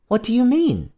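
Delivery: high-pitched queenly question; startled cry. A narrator's reading. Queen questioning her husband, high startled cry. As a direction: ask in high bewilderment. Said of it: "cried the queen".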